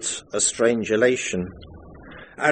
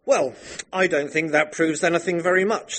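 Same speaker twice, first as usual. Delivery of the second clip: higher plummy gentleman's tone; a pompous upper-class colonel; upper-class magistrate; 7 semitones higher than usual. Acted. The magistrate dismisses the evidence, pompous upper-class. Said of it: "said Colonel Julian the magistrate".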